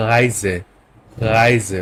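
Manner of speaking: "রাইজে" with a German R from the back of the throat; throaty German R. Shown as a demonstration. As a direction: start "রাইজে" deep in the throat. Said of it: "ভিতর থেকে আসবে". ("রাইজে"-র র গলার ভিতর থেকে)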